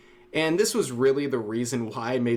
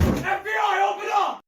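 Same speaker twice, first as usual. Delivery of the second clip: FBI raid shout. Pretend. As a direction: yell it like agents at the door.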